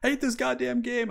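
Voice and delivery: high angry yelling at the game; frustrated outburst. Retold or imitated. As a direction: rage at losing, half laughing. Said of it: "it does get me very frustrated".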